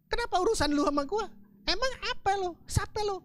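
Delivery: a rebuking voice asking who he is; scolding rebuke. Acted. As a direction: put him in his place. God rebukes the preacher.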